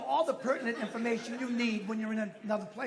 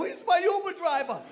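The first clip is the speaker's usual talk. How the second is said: exasperated; 7 semitones higher, 6 dB louder than usual. Retold or imitated. Punchline to neighbor.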